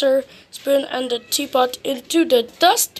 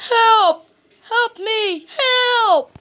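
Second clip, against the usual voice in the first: high, falling calls for help; acted storybook distress cry; 8 semitones higher, 3 dB louder, slower than usual. A narrator's reading. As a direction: call out for rescue from inside a house. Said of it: "heard a cry".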